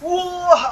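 high, loud cry of alarm; startled yell. Real, not acted. Player finds the way shut on them, yelling high. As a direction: yell out in sudden shock.

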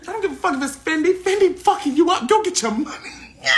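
high, excited, fast-rising roast; scoffing outburst. Real, not acted. Streamer roasting a man's Fendi hat, high scoffing. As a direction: scoff high and lively, laughter creeping in.

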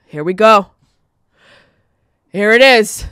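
loud, weary announcement of doom; dad's dramatic groan. Retold or imitated. Dad spotting the first snowflake, loud mock dread. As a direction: boom it out like a dad spotting snow. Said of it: "My dad would go".

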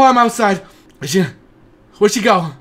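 ordinary delivery

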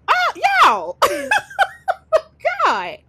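loud burst of disbelieving laughter; shocked laughter. Real, not acted. Reacting to a wild game scene, loud shocked laughter.

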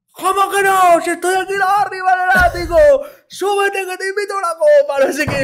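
put-on high, loud drunk shouting up from below; drunken yelling. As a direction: bellow a drunk invitation from the wall. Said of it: "empieza a gritarla".

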